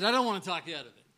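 first take rushed out quickly; quick retold line. Owner hurrying to reassure the player, fast. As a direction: rattle it off fast, then repeat. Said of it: "he said".

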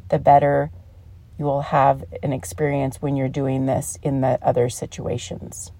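usual speaking voice

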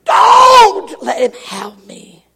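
slow, wailing high scream; long, anguished cry for help; terrified scream. Retold or imitated. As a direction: wail the plea, falling away at the end.

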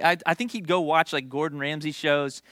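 ordinary talk, nothing marked

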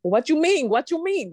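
high-pitched, punchy Dominican-English; Dominican family accent. Retold or imitated. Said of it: "I get around my family and I'm like".

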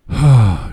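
loud, heavy exhaled sigh; big sudden breath let out; audible whoosh of breath. A narrator's reading. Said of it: "let it out in an audible whoosh".

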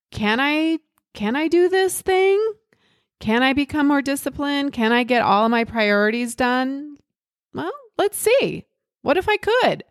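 high-pitched, wondering self-questioning; curious, excited. Acted. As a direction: ask yourself with open, hopeful curiosity. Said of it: "Be so curious. I'm excited to find out".

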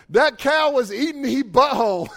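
put-on loud, high little-kid voice; excited four-year-old. Little son telling dad about the cow, loud gleeful blurt. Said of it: "he was, like, maybe four years old".

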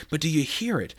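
ordinary delivery

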